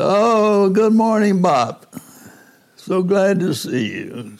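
high, bright imitated Cuban accent. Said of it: "he called me in his Cuban, uh, accent".

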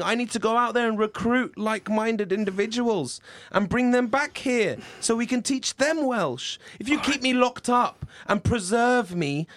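high, eager rallying speech; fired-up son pitching a revolution, high-voiced; impassioned plea. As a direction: urge Dad on with rising fervour. Son excitedly urges Welsh revolution.

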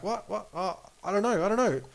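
lightly acted, high-pitched flustered denial; flustered waitress. Waitress cornered by the angry wife, mock flustered. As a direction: play caught out, voice jumping up.

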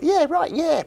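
very high, manic comic voice; Lee Evans on stage. Acted. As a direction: squeak it out as a hammed-up stage act. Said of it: "Lee Evans on stage".

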